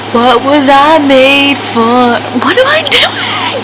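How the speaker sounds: hammed-up despair; mock wail. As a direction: wail it like a tragic heroine. Dramatic wail over finished book.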